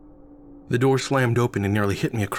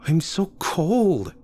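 shivering; 8 semitones higher, much slower than usual. Acted. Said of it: "Susan shivered as she giggled".

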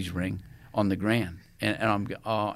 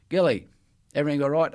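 raised, higher call down the aisle; checking on the team, loud and high; 5 semitones higher, 5 dB louder, faster than usual. Retold or imitated. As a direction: call out to a player. Coach checking on him on plane.

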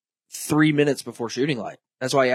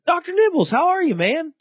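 much higher, friendly glad-handing voice; high-pitched folksy hello; cheery game warden; 8 semitones higher, 3 dB louder than usual. Retold or imitated. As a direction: greet him like an old buddy. Warden greets doctor friend cheerfully.

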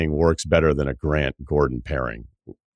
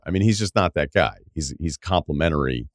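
ordinary delivery

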